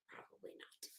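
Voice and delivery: barely breathed, dry put-down; sarcastic whisper. Real, not acted. Answering her own question about Betty, a sarcastic whisper. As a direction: drop to a whisper for the punchline.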